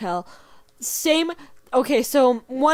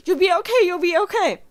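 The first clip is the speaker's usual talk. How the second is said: higher, hurried mock comfort from mom; mom reassuring; 7 semitones higher, 3 dB louder than usual. Mom driving her hurt kid, hurried higher reassurance. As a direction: play the mom soothing while driving fast. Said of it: "she's like".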